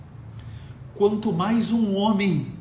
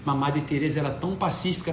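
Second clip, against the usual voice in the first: softer, quick objecting voice; quiet objector; 4 semitones lower, 5 dB quieter, faster than usual. Retold or imitated. A critic objects to Diego, quick and soft. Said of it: "fala assim".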